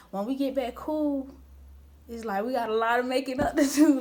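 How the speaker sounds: usual speaking voice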